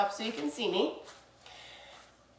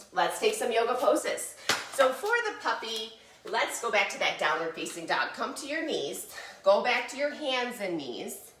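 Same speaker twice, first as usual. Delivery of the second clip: ordinary delivery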